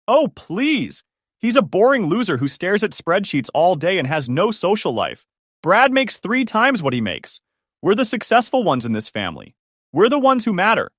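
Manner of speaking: high, indignant contempt; acted exasperation with pitch leaping up; scornful outburst. A narrator's reading. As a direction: hurl it as a haughty, angry put-down. Ashley's scornful outburst in the quarrel.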